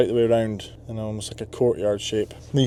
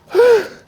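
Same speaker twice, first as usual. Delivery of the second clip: big gasp; 10 dB louder than usual. Real, not acted. Panting after exertion.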